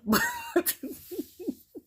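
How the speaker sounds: loud, high burst of real laughter; high cackling laugh. Real, not acted. Cracking up at the commentators, a real high cackle. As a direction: crack up helplessly. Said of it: "cracking up".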